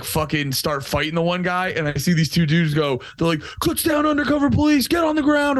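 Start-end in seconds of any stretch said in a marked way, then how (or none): 3.6-5.6 cop yelling. Retold or imitated. Cops shouting at the fight.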